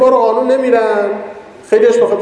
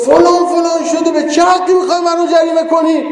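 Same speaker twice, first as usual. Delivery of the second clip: high, indignant mock shout at the officer; old woman yelling; 10 semitones higher than usual. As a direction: imitate the old woman scolding the policeman. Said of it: "دو تا داد زد".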